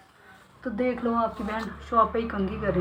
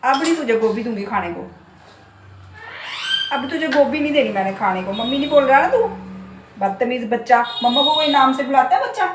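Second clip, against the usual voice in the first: loud scolding; 3 semitones higher, 8 dB louder than usual. Real, not acted. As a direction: tell the parrot off loudly. Scolding pet parrot.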